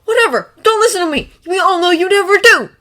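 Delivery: put-on young man yelling as he storms out of the room; yelled. A narrator's reading. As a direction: give up in a loud angry huff.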